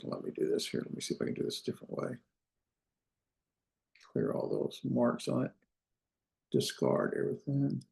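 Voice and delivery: very soft, half-whispered mumble; muttering to self. Real, not acted. Teacher fixing the slide, muttering to self. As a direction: murmur it under your breath.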